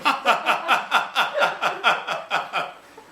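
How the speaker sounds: high cackling laugh; loud laugh. Real, not acted. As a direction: burst out cackling. Laughs after wine line.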